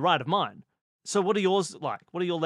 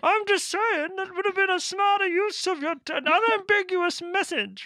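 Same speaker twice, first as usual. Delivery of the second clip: through a tube; 13 semitones higher than usual. Pretend. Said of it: "This is my through a tube voice".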